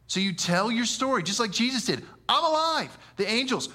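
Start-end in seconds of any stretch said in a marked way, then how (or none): 2.3-3.0 high ringing "I'm alive"; a bright triumphant cry; triumphant proclamation. Retold or imitated. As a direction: announce it like good news. Jesus proclaiming.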